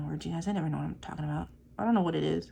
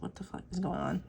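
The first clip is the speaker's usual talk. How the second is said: muttered disbelief; 6 dB quieter than usual. Retold or imitated. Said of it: "I was like".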